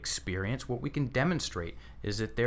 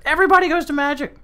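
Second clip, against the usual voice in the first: excited exclamation; 12 dB louder, faster than usual. Real, not acted. Reacting to the host's answer.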